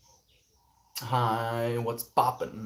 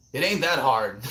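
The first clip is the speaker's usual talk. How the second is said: tentative Texan twang, louder; amateur go at a Texan accent; attempted Texan accent; 3 semitones higher, 6 dB louder than usual. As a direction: try a Texan accent, badly faked. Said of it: "I can't do an American accent".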